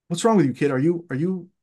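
mildly exasperated, halting scolding; frustrated priest. Retold or imitated. Priest scolding a nervous kid at the altar, mildly frustrated. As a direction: imitate a priest losing patience. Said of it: "who's getting frustrated at me".

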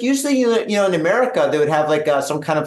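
usual speaking voice

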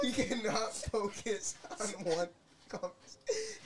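high, loud laugh into words; can barely talk for laughing. Real, not acted. Cracking up at the friend.

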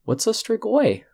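put-on a young girl asking her elder; little girl. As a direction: ask it like a curious small child. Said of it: "you hear this little girl say".

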